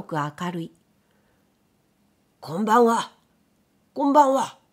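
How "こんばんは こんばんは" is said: loud call from outside the door. A narrator's reading. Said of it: "外で誰かが呼んでいた".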